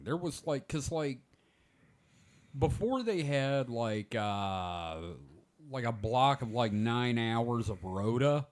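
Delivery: normal talking voice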